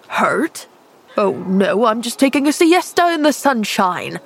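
lightly voiced man, mildly sarcastic and irritable; a hint of a cranky injured man, brushing off her question; irritable snap. Injured man snapping sarcastically at the girl who found him. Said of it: "snapped the man irritably".

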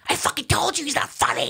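very high mock yelling; imitated Rogan. Rogan complaining to Mitzi about the comic, high strained mock yell. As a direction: squeal it like a hammed-up heckler.

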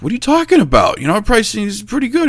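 very high, defensive squeak; retold incredulity. Answering competitor about pricing, high incredulous protest.

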